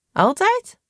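very high, disbelieving; incredulous. A narrator's reading. Saar questions Obi's claim, incredulous and high.

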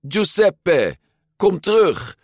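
high, loud urgent call. A narrator's reading. Luca to his escaping fish, calling out. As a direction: call back a runaway. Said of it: "riep Luca".

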